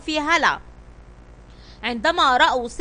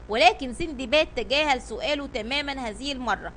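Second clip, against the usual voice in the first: usual speaking voice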